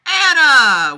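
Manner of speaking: loud, high call across the aisle; calling across the shop. Acted. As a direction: shout her name from afar.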